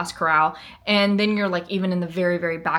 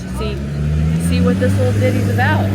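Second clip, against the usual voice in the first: deep silly voice; 5 dB louder, faster than usual. Pretend. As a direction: drop way low and playful. Playful low voice.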